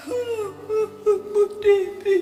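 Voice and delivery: high, drawn-out sobbing cry of a name; retold maid sobbing her name; weeping maid. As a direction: cry the name out through tears. Maid weeping on seeing preacher.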